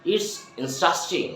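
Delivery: slow "It's interesting", middle squeezed to "in-stras-ting"; reduced native pronunciation. Shown as a demonstration. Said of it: "আমরা কি বলবো?". ("interesting" ধীরে "ইনস্ট্রাস্টিং")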